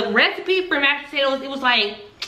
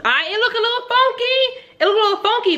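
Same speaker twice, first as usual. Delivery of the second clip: amused high; 12 semitones higher than usual. Real, not acted. Laughing at funky meatloaf.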